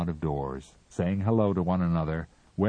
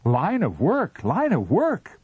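high, loud plaintive echo; bleated; 11 semitones higher, 6 dB louder than usual. A narrator's reading. Captain bleats back in dismay, high. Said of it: "bleated the captain".

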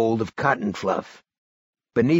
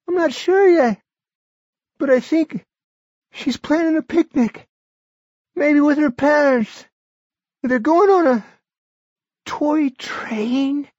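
put-on high, loud, strained old man; a feverish old man pushing through; sick old man; 6 dB louder than usual. A narrator's reading. Barry voiced.

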